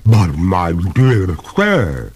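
reluctant ghost lady, words drawn out; pale reluctance. A narrator's reading. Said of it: "with a pale reluctance".

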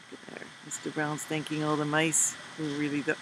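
plain reading voice

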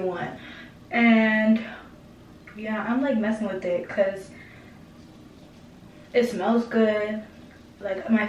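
speaking through fingers; muffled by hand; hand over mouth. Real, not acted. Seen 0: Hand rests on chin, cheek or covers mouth through most frames.

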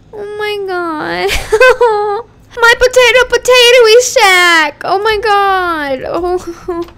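slow, wailing grief at the potato shack; mock wailing. Mourning her old shack.

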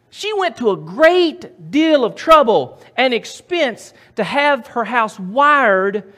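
high, loud preacher's emphasis; full-force preaching. Real, not acted. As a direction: punch it out to the congregation.

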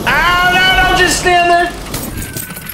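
high, drawn-out cry of alarm; alarmed yell. Real, not acted. Player sees his troops just standing there, alarmed high yell. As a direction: wail at them in high-pitched alarm. Yelling at idle minions.

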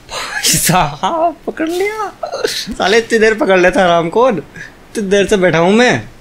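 high, slow, disbelieving cry of relief; finally, he grabbed it!; excited outburst. Real, not acted. Finally grabs ledge after long wait. (उत्साह से चिल्लाना)

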